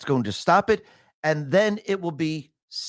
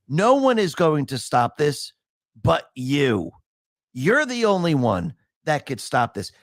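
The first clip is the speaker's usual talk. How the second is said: ordinary talk, nothing marked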